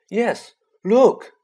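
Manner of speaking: high, loud excited call. A narrator's reading. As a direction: point it out eagerly. Ron spots the first move.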